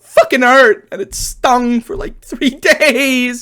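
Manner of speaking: high, drawn-out complaint; exaggerated pained wail. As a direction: wail it out, stretching the last words. Relives how much it stung.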